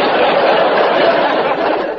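hearty laugh; triumphant laugh. Acted. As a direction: laugh out in relief. Triumphant laugh at double flag.

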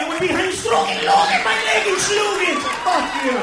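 loud, high shouting tirade; comic shouted rant at the French waiter; furious rant. Retold or imitated. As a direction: rip into him full volume.

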